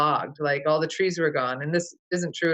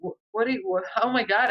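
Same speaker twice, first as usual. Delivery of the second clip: mildly dismayed, higher-pitched challenge; retold upset; 5 semitones higher than usual. Confronting the neighbor over the dead owl, mildly upset. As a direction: stammer in mock disbelief, pitched up. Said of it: "I was so upset".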